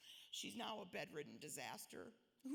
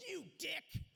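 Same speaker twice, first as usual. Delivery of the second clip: much higher and louder, furious and crying out; tearful yell; 8 semitones higher, 4 dB louder than usual. Retold or imitated. Crying and yelling at the neurologist who took her hope, furious. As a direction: yell it through tears, pushing back hard. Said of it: "I burst into tears and yelled at him".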